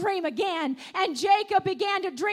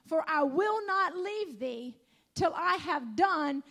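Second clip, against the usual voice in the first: solemn scripture reading; 3 semitones lower, 4 dB quieter than usual. Acted. Preacher reading Genesis to the congregation, softly reverent. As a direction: read the promise gently, with awe.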